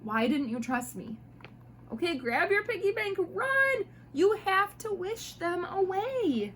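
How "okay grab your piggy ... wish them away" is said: high, loud, urgent hammed-up warning; mock panic. A narrator's reading. Mom reading a story, hammed-up panicked urging to run. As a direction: sound the alarm for the kids.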